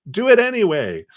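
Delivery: high, loud mock-desperate order; acted drowning person shouting; frantic demand. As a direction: insist in a hammed-up panic.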